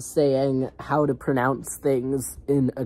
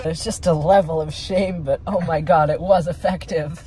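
excited; 6 semitones higher, 10 dB louder than usual. Real, not acted. Excited reaction.

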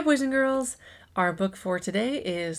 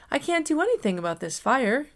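higher, lightly croaked frog voice; a light hint of a croaky frog; croaking frog; 6 semitones higher than usual. Frog admitting it can't fight the fire, lightly croaked. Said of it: "croaked the frog".

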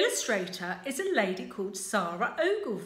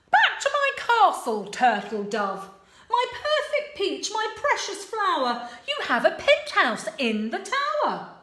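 louder, hammy heroic prince; lightly voiced proud prince; boastful prince; 7 semitones higher, 6 dB louder than usual. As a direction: puff up like a show-off prince. Prince sweet-talking Sue.

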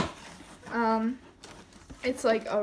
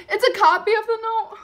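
high, loud, laughing through tears; laugh-cry the realization; teary laughing squeal; 9 semitones higher, 11 dB louder than usual. Real, not acted. Fan laugh-cries finding the note is only a copy.

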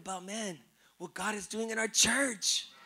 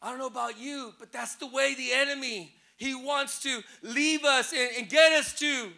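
ordinary talk, nothing marked

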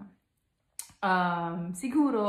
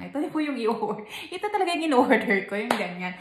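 higher, louder, hurried mock protest with laughter; exasperated customer; 4 semitones higher, 7 dB louder than usual. Customer not understood at a restaurant, laughing mock exasperation. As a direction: play up a flustered diner insisting on the order.

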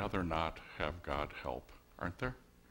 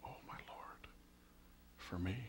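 hushed, amazed, as if whispered to oneself; awed inner thought; 13 dB quieter than usual. Retold or imitated. A new believer tasting forgiveness, hushed awe. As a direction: breathe it out in disbelief. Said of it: "they think".

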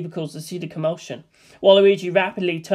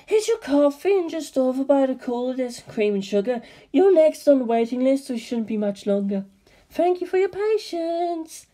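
high, polite receptionist voice; sweet front-desk helper, higher up; 7 semitones higher than usual. Acted. Receptionist greets waiting visitor.

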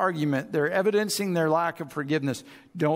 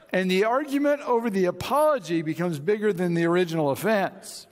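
usual speaking voice

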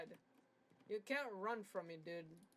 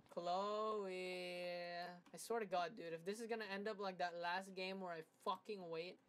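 normal talking voice

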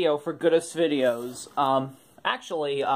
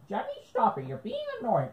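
very high, squeaky copy of the words; a kid mockingly repeating his brother's words; mocking echo; 7 semitones higher, 7 dB quieter, faster than usual. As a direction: parrot him back in a squeaky kid voice. Now you're gonna repeat everything I say.

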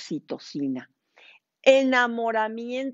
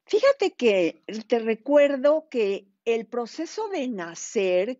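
ordinary talk, nothing marked

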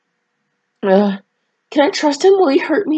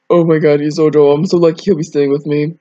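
Mark's voice; 3 semitones lower, faster than usual. Acted. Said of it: "I sound like one of those edgy vampires".

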